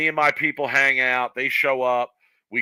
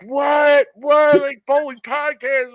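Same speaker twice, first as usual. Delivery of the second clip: high, loud shouted "What?", slower on "bowling podcast"; mock disbelief; 14 semitones higher, 7 dB louder, much slower than usual. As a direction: play the friends' stunned outburst. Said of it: "And they were like".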